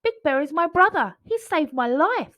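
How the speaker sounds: put-on high, loud pleading child's call; child shouting. Boy begging his father to spare the bear, high loud shout. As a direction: shout to stop him, urgent. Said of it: "he shouted".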